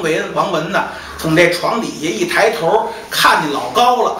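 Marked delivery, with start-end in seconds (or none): none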